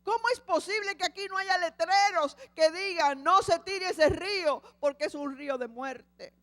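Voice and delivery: father shouting in grief and anger. Acted. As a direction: yell your outrage at the missing signs. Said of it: "empezó a gritar".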